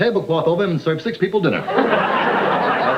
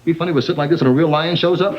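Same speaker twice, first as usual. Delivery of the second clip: ordinary delivery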